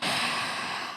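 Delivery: long, airy sigh of dismay; exasperated sigh. Pretend. Streamer reacting to snapped uncooked spaghetti, exasperated sigh. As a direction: let out a weary breath.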